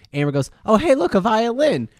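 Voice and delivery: usual speaking voice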